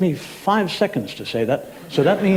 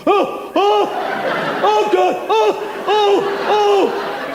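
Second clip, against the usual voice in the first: much higher hammed-up "oh, oh" moans; mock orgasm moans; 12 semitones higher, much slower than usual. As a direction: ham up the agonised lovemaking moans. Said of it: "they go".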